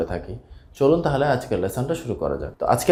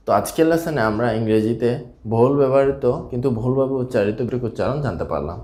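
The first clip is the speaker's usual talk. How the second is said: normal talking voice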